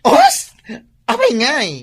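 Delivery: very high, loud shocked cry; shocked gasp. Acted. Parlor owner crying out in disbelief at her friend's spending. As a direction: squeal in disbelief at the cost.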